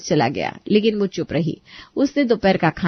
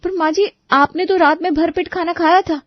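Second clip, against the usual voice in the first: much higher, gently protesting bride; surprised daughter-in-law; 10 semitones higher than usual. Acted. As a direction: object politely in disbelief. Preeti objects.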